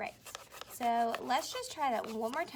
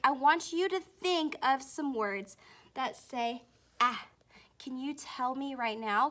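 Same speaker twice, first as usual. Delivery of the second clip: ordinary talk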